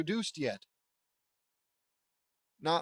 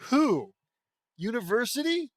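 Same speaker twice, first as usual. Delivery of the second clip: incredulous; 7 semitones higher, 6 dB louder than usual. Real, not acted. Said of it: "incredulous reaction".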